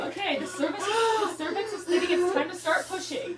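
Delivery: high, loud urgent coaching, laughing through it; mock urgent doctor. Doctor coaching a screaming patient, loud and half-laughing. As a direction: shout calming orders while cracking up.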